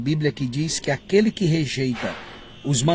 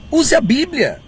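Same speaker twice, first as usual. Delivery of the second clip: higher, emphatic "Use a Bíblia"; firm repeat; 9 semitones higher, 4 dB louder than usual. Shown as a demonstration. Preacher repeating his plea to use the Bible. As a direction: drive the advice home. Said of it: "Olha, diga o seguinte".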